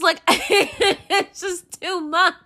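laughing through the words, high; a real exasperated laugh as she vents. Real, not acted. Venting to the camera, laughing it off.

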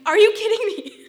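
high loud are you kidding me; incredulous shout. Retold or imitated. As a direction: yell at the text message, fed up. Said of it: "I'm like".

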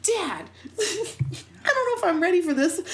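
laughing, high and loud plea to dad; playfully flustered, voice jumping up; mock embarrassed protest. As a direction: act out an embarrassed kid begging off. Acted protest to eager dad.

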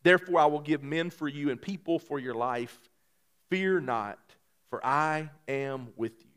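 soft, measured reading of scripture. Acted. Pastor reads scripture softly to the congregation. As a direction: read the verses gently to the church.

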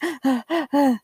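a quick startled intake of breath; sharp gasp. Retold or imitated. Imitating a panicked shopper's sharp gasp. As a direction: gasp like someone suddenly cornered. Acting out panicked person's reaction.

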